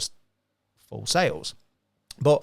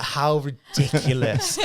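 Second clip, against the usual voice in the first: talking through real laughter, slowed down; words breaking up with giggles; laughing; 2 semitones higher, 4 dB louder, much slower than usual. Real, not acted. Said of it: "I could not stop laughing".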